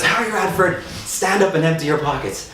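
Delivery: high mock-stern teacher voice; a hammed-up strict teacher. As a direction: play the cross teacher ordering him up. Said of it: "she goes".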